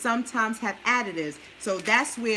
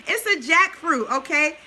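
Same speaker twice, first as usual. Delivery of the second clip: amused correction; 5 semitones higher, 5 dB louder than usual. Real, not acted. Correcting her chicken salad slip for viewers, amused and louder. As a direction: set the record straight with a giggle.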